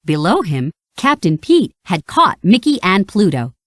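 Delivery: ordinary delivery, nothing marked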